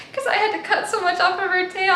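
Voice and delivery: laughing through the words; giggly, fast and high. Real, not acted. Laughing about cutting pet's tail.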